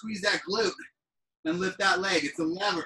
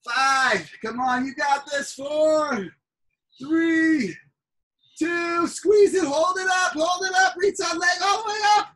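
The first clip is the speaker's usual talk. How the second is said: higher, louder, pushing hard; loud coaching; 6 semitones higher, 4 dB louder than usual. Real, not acted. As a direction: drive them through the last reps. Coach pushing through last reps.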